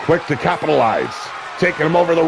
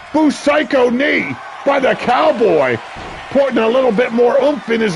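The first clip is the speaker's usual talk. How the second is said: hyped call; 8 semitones higher than usual. Real, not acted. Calling a big move.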